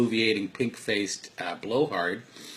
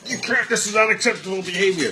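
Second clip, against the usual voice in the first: loud, high-pitched telling-off; exasperated parent laying down the law; mock parental scolding; 11 semitones higher, 9 dB louder than usual. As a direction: scold a child, hammed up and loud. Voices a parent scolding a child.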